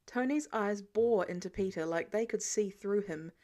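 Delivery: ordinary delivery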